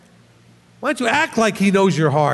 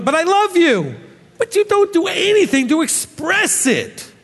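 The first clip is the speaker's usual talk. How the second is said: mock protest; 8 semitones higher than usual. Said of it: "and I say".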